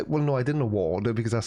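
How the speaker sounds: ordinary talk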